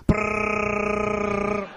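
a rapid trilled R like an Uzi firing; rolled-R gunfire. Retold or imitated. Kid imitating Uzi fire with a rolled R. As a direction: roll the R hard like a machine gun. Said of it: "my Mexican friends would be like".

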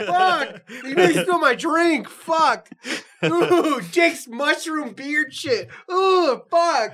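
high shocked shrieks; shocked shrieking. Real, not acted. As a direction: yelp in alarm over the spill. Spilled drink, shrieking.

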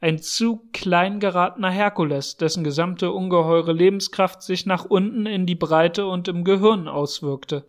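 plain reading voice

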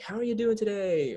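high-pitched, sing-song crowd greeting; lifted, chirpy imitation of a host; upbeat host greeting.

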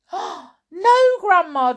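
high, loud "no"; urgent boy's objection; alarmed protest. Acted. George objects to Grandma's accusation, high and urgent.

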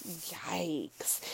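breathy whispered yikes under the breath; a cringing, barely voiced yikes. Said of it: "I was like".

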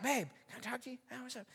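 very soft, private exchange; retold hushed aside. Discreet aside to wife, retold.

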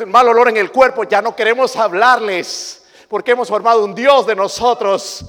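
loud, high rising sermon cry; fiery preacher hammering the point; preaching at full force. Acted. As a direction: build it up to a shout.

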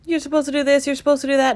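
high-pitched mocking imitation of naggers; mock nagging. Echoing people who dictate what to do, mock nagging. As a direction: parrot the rules in a high mocking voice.

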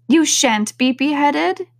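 high, slow storybook Alice; protective Alice. A narrator's reading. Alice protecting the gardeners, high and insistent. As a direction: promise it firmly in a high voice.

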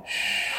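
a sharp breathy hush to silence others; hushing someone in hiding; shushing. Acted. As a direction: hush them before they give you away.

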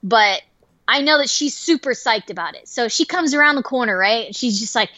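ordinary talk, nothing marked

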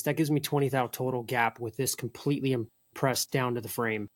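usual speaking voice

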